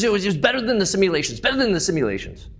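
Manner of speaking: delighted Spanish-accented boast, mimicked fast. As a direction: rattle it off like an excited Spanish-speaking engineer. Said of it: "He's got a really thick Spanish accent".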